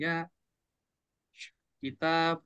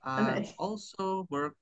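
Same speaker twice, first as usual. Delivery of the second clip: slow model phrase; 2 semitones higher, slower than usual. Shown as a demonstration. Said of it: "models the correction for learner".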